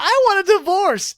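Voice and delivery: high, loud huffy wife; mock shrill wife. Wife storming out on the cop, mock shrill. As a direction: fling it at him like a fed-up wife. Wife voiced in retold movie.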